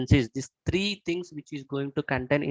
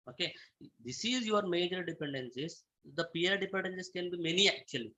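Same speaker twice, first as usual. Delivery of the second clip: ordinary delivery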